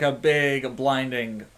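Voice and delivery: ordinary talk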